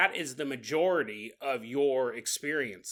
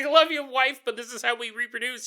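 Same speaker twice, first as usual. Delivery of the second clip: raised, high-pitched pretend husband; mock emotional plea; 7 semitones higher, 5 dB louder than usual. Alien husband consoling his wife mid-birth, mock plea. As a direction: call out over the birth, hammed-up.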